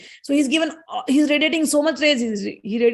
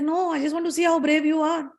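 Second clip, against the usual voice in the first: higher-pitched denial, lightly put on; innocent goading; 5 semitones higher than usual. Goddess goading Indra to prove bravery, innocently. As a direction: protest innocently while daring him.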